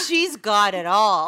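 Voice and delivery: put-on a boy solemnly declaring her perfect; gushing kid. Boy telling Jonathan about Miss Tracy, slow and emphatic. Boy's gushing verdict concluded.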